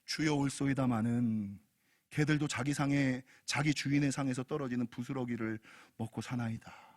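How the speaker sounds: low, subdued supplication; humble plea. Acted. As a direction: plead humbly in a deep, measured voice. Voices the woman's humble plea.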